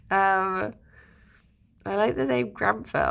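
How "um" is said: ordinary delivery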